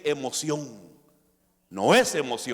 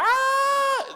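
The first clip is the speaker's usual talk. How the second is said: high, sustained loud "aah"; very high-pitched mimicked cry; mock scream; 5 dB louder than usual. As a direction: imitate someone screaming out in church. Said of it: "si alguien no grita".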